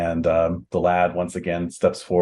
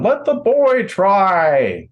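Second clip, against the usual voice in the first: high, loud, drawn-out call; shout from crowd; 12 semitones higher, 7 dB louder, much slower than usual. Acted. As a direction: yell it slowly over a crowd. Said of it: "a shout from the crowd".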